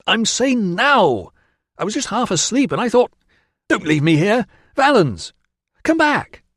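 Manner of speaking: desperate high shouting. A narrator's reading. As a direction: yell after someone who left. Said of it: "shouting at an empty space".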